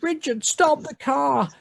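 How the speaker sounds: very high panicked plea; Gail shouting. Acted. Gail shouts at her husband driving, high and frantic. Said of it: "Gail shouts".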